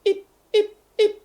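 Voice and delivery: very high tiny squeaks; mouse squeaks. Acted. Narrator imitating the mouse's three squeaks, very high. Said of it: "pousse trois petits cris comme ceci".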